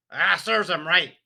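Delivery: loud, high scornful scoff; gruff father. A narrator's reading. Father scoffing at the boy in the story, loud and scornful. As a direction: sneer it at the boy. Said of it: "Mister Wormwood said".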